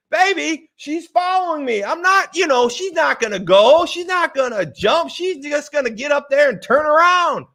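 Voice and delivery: high-pitched, protesting retelling; pleading husband. Retold or imitated. Husband defending himself to his screaming wife, mock pleading.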